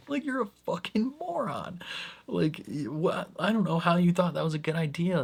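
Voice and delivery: high, scornful disbelief; exasperated. Retold or imitated. Fan scolding the reckless doctor, high and scornful. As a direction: talk down to the idiot.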